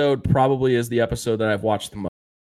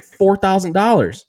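higher, louder mock disbelief; retold incredulous outburst; 9 semitones higher, 5 dB louder than usual. Michael balking at the total for the books, mock shock. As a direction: act out balking at the price.

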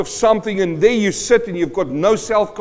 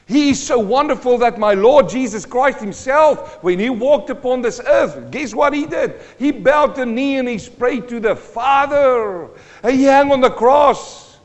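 raised, loud, impassioned preaching; full-force preaching; 6 semitones higher, 6 dB louder than usual. Real, not acted. Preacher telling how Jesus prayed to the Father, full-force preaching. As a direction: drive it home high and loud from the pulpit.

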